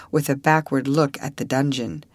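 ordinary delivery

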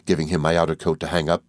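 plain reading voice

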